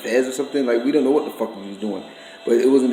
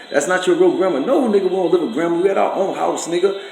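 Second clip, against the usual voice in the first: higher-pitched angry telling-off; heated rant; 6 semitones higher than usual. Real, not acted. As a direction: lay into him, getting more fired up. Heated rant at brother-in-law.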